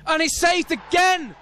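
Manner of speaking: very high, drawn-out cry of a second save; astonished excited call. Real, not acted. Commentator calling the retaken penalty saved, excited. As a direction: soar up in pitch and stretch the last word.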